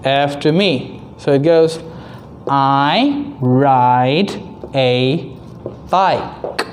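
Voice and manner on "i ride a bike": put-on slow sing-song read for kids; broad singsong kids theatre. Shown as a demonstration. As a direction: model the sentence for the class. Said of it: "I will read first and then you read".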